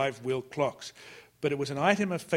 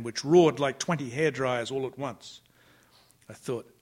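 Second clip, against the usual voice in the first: plain reading voice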